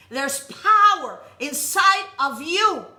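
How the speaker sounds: loud, slow, rousing preacher; preaching at full force. Acted. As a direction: rouse the congregation, hammering each word. Preaching climax, rising.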